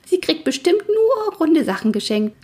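very high, amused little pony; gleeful gurgle. A narrator's reading. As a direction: squeak it out gleefully. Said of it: "Der kleine Schecke gluckerte vergnügt". (vergnügt gluckernd, ganz hoch)

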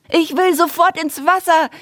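put-on high excited squeal of a little girl; squeaky girl. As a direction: squeak it out, eager to jump in. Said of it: "quiekte Emily".

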